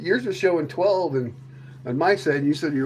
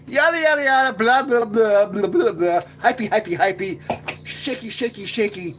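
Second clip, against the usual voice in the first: high, loud hammed-up anchor babble; a light CNN anchor parody; mock news anchor; 6 semitones higher, 5 dB louder than usual. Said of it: "Now I sound like C N N".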